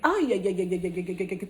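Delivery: ordinary talk, nothing marked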